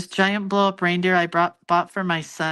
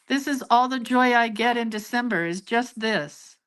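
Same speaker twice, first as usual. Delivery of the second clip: ordinary talk, nothing marked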